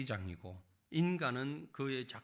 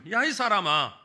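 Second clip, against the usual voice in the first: scolding; 7 semitones higher, 12 dB louder than usual. Acted. God answering every complaint, stern scolding. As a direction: thunder the rebuke like God scolding his creature. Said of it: "꾸짖는 말입니다".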